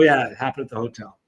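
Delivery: normal talking voice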